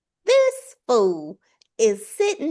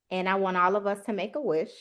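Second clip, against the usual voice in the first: usual speaking voice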